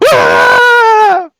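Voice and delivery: loud, high-pitched scream; full-volume high scream; shouting back. Acted. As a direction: shriek right back in shock. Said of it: "they're both kinda shouting".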